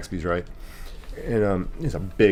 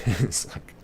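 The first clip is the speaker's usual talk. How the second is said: whispered laugh; 4 semitones higher, 9 dB quieter than usual. Real, not acted. Laughing after own joke.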